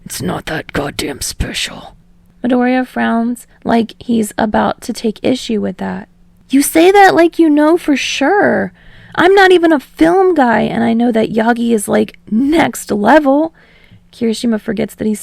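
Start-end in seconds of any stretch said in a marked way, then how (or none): 0.0-2.0 low, soft, gruff scowl; low dismissive grumble; scowling Bakugo. Acted. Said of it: "Bakugo scowls at him". 6.5-13.5 high, louder eager insistence; high excited pushback. Acted. Kirishima argues excitedly.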